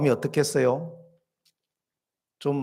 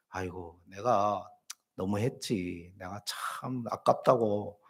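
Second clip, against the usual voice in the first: low, hushed acted regret; soft, low musing of a sorry gift-giver; rueful self-talk; 6 semitones lower, 8 dB quieter than usual. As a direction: sigh it out quietly to yourself, lightly acted. Acts out a remorseful giver's thought.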